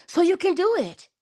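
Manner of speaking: high, bright cheer: you can do it; encouraging cheer. Real, not acted. Encouraging the viewers.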